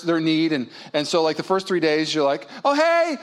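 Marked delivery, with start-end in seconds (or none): 2.6-3.2 high, cheery "oh, hey"; eager greeting. Acted. Husband greeting his spouse in the first days, eagerly. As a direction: greet them brightly, pitched well up.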